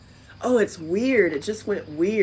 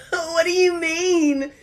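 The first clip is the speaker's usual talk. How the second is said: high, slow question broken by laughter; laughing disbelief; 12 semitones higher, 5 dB louder, slower than usual. Real, not acted. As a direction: laugh it out, half crying with amusement. Laughing at the stuck workbook.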